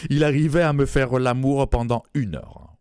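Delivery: ordinary delivery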